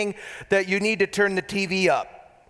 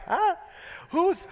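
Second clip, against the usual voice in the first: high, blank little huh; a child not getting it; puzzled kid; 13 semitones higher than usual. Retold or imitated. Kids' blank reaction to Jordans.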